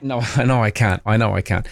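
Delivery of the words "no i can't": usual speaking voice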